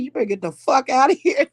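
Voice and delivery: loud, fast command to leave; stern order. Retold or imitated. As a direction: throw the spirit out. Ordering spirit out.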